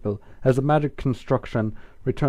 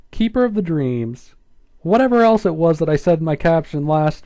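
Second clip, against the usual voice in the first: outraged rant; 6 semitones higher, 7 dB louder than usual. Real, not acted. Outraged reader comment on the story.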